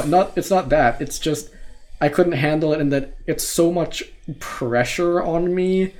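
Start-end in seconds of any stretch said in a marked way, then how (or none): none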